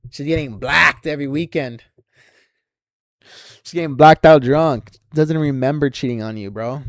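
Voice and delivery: loud, jeering rant; taunting yell. Acted.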